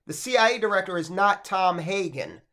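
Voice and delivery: normal talking voice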